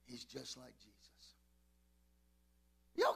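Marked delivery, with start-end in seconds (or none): 0.0-1.3 very soft, low, almost whispered; hushed. Real, not acted. Pastor confiding he has the Holy Ghost, hushed. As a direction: drop right down to a private murmur. Hushed aside after the fish cry.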